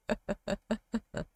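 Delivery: short soft nervous laugh; awkward laugh. Real, not acted. Laughing at Orphan's attack, soft nervous laugh. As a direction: laughs despite herself at a grim scene. I don't know why I'm laughing.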